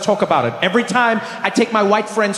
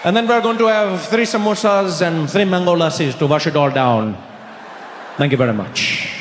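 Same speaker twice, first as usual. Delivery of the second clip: put-on Indian accent; 3 semitones lower than usual. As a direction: show the waiter these are your people, Indian accent. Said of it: "I just jumped up and I was like".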